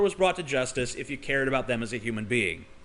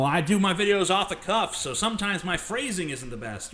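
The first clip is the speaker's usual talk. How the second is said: loud, faster hammed-up excuse; mocking Paul; 2 semitones higher, 6 dB louder than usual. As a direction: parody a flustered excuse-maker. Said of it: "He says".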